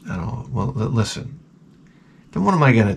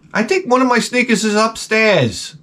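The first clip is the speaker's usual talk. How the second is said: put-on whiny kid voice; 9 semitones higher than usual. Son's voice.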